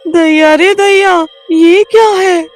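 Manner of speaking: high, loud, slow alarm; startled village woman; frightened gasp. Acted. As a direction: recoil at the sight. Sees woman on swing.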